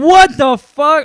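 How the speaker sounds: loud, high, slow drawn-out shock; outraged disbelief. Real, not acted. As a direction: stretch it out in mock outrage. Outraged reaction to friend story.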